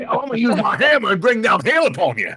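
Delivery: high-pitched hammed-up Thor threat; Southern Viking. Riffing on Vikings with cowboy hats, mock Southern Thor. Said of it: "some Thor lookalike".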